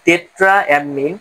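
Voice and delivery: Tetraammine drawn out slowly, each syllable clear; a teacher spelling out tetraammine slowly; slow careful naming. Shown as a demonstration. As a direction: say tetraammine at half speed for students to copy. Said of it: "লিগ্যান্ডটাকে উচ্চারণ করব".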